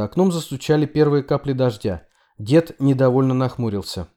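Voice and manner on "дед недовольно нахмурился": usual speaking voice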